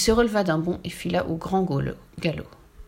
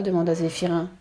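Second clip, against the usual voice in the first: ordinary delivery, nothing marked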